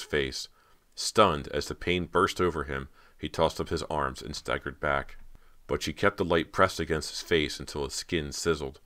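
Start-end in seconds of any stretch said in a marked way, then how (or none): none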